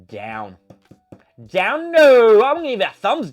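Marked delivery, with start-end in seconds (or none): 1.5-3.3 high questioning word, then a loud shouted no; mock disbelief. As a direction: play outraged disbelief, jumping up high and loud. Mock disbelief at own rating.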